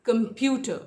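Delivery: Computer as com-PYU-ter; computer with the middle syllable punched; second-syllable stress. Shown as a demonstration. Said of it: "second syllable पे stress है".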